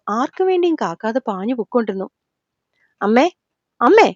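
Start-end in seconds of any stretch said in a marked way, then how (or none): 3.0-4.1 insistent call, rising at the end; loud call. Acted. Son summons his mother from another room, calling out. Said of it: "ശ്രീയുടെ ഒച്ചയിലുള്ള വിളിയിൽ".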